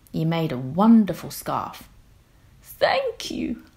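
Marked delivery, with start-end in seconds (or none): 2.6-3.6 very high whispered thank you; thankful giraffe. Acted. The giraffe thanking George for the tie, a very high whisper. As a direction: breathe a tiny grateful thanks. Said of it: "said the giraffe".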